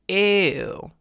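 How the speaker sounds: loud, thick, disgusted "ew"; heavy vocal weight, disgusted; emphatic ew. Shown as a demonstration. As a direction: push it out heavy, almost frying. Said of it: "emphatic ew sound".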